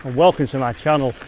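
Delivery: breathless, talking while climbing; panting between phrases on an icy climb; out of breath. Real, not acted. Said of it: "if I sound out of breath".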